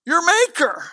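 high, loud preaching rebuke; preacher at full force, scolding the congregation. Acted. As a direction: thunder it from the pulpit.

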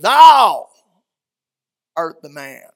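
thrust the accusation home; accusing. Retold or imitated. Prophet pointing at the king before the whole court. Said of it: "pointed at David".

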